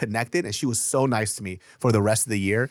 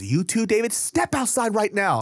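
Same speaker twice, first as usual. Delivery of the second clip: imitated stern teacher; 9 semitones higher than usual. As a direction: send a student out, exasperated. Teacher ordering laughing student out.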